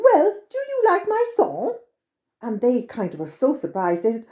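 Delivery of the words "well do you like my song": high, sprightly little man. A narrator's reading. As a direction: voice a tiny cheerful leprechaun. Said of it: "he said to them".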